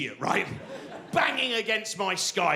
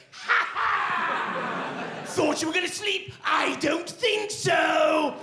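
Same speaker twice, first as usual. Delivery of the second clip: taunting brain voice; 12 semitones higher, slower than usual. Retold or imitated. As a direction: taunt the sleeper in a shrill high shout. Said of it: "your own brain just goes".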